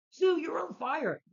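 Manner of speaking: very high, loud, drawn-out imitation of alarm; mock alarmed shriek. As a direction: cry out a hammed-up fire warning, slowly. Mimics Reed alarmed at Sue.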